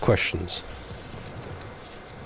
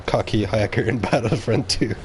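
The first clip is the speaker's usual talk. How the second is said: ordinary talk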